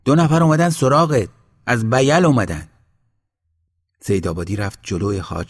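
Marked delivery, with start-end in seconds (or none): 0.0-2.7 loud, high-pitched insistent announcement; louder call. A narrator's reading. Villager insisting to the sheikh about his visitors, loud and high. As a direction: raise your voice well up to get his attention. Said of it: "با صدایی بلندتر گفت".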